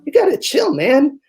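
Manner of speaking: high mock surfer dude urging calm; laid-back buddy. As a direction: talk a stressed friend down, dude. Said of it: "They go".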